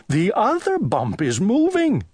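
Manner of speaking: high alarmed cry. Acted. Owl in bed watching the bump move, crying out. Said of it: "cried Owl".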